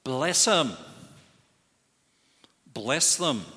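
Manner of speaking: loud firm command, "Bless them" hammered; emphatic command. Retold or imitated. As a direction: ring it out like a decree. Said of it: "what does the Lord say?".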